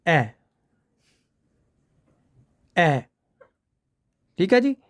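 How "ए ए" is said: short "ए" said lightly; short vowel demo. Shown as a demonstration. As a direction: say "ए" short and clipped, as the first part of a diphthong. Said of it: "इसको कैसे pronounce करते हैं?".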